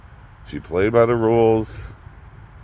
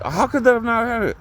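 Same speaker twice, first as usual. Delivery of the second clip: retold disbelief; faster than usual.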